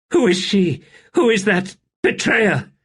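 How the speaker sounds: high, loud accusing cry; frantic demand. A narrator's reading. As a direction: hurl the question at her. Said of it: "Rulad's frantic demand".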